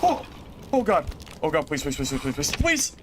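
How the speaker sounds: panicked pleading, rising to a shriek; begging for life in terror; terrified horror victim. Real, not acted. Near death in dungeon fight.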